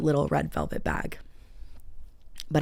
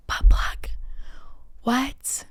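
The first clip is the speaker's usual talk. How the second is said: voiceless, incredulous whisper; hushed, breath-only shock; stunned whisper; 7 semitones higher, much slower than usual. Retold or imitated. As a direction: whisper it in disbelief. Stunned punchline, mouthed in disbelief.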